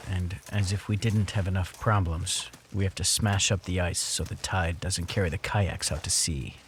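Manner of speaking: low, calm voiceover; documentary narration. A narrator's reading.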